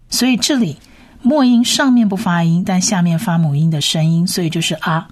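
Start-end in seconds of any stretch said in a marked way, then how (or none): none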